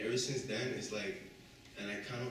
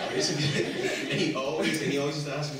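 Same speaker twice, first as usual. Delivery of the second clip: loud, higher words through laughter; real laughing speech; laughing while talking; 6 semitones higher, 14 dB louder than usual. Real, not acted. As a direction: keep talking as the laughter spills over. Laughing while telling the story.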